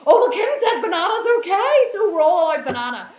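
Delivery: high, loud excited followers; mock gleeful clients. Imagining her followers misquoting her, a loud high squeal. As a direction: squeal like you got permission. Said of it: "everyone would go".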